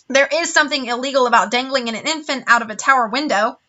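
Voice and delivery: plain reading voice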